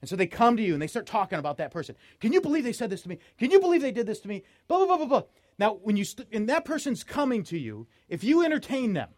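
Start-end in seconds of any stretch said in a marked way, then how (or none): 2.2-5.2 fast, high-pitched griping; mock mad. Mad friend complains about someone, in mock outrage. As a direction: imitate an indignant complainer rattling on. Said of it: "somebody who's mad at someone else".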